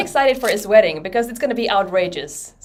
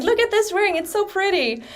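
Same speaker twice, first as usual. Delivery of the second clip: gushing; 10 semitones higher than usual. Retold or imitated. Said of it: "I'm like".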